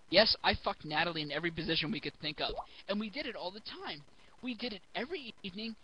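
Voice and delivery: ordinary talk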